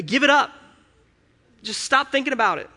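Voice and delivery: high, loud, insistent command; God, emphatic command. Retold or imitated. God telling him to drop his worry, insistent. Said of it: "that's how he talks to me".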